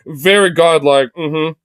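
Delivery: lightly voiced, slow, pompous savior giving approval; a hint of a benevolent lord praising his flock; playful sendup of a self-satisfied god; mock Jesus. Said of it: "Christ is gonna look at you today and be like".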